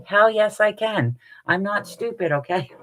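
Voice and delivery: high, emphatic mock indignation; mock-indignant boast. Answering a horror-movie survival quiz, mock-indignant boast. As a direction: answer as if insulted by the question.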